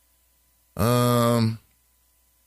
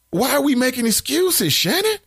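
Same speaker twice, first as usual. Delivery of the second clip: much higher, disbelieving challenge; incredulous; 10 semitones higher than usual. Real, not acted. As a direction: call her out, pitch climbing on the name. Host pushes back on commenter.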